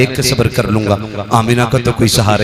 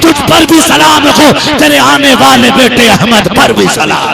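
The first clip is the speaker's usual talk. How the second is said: high, loud rapturous sermon storytelling; full-force preaching; 12 semitones higher, 6 dB louder than usual. Acted. Preacher telling the crowd of Amina at the well, impassioned full-force delivery. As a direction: build to a loud, high-pitched peak and hold it there.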